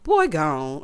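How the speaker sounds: put-on "Boy gone" low, with GONE hit hard. Shown as a demonstration. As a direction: drop down deep and land on "gone". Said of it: "really hit the gone".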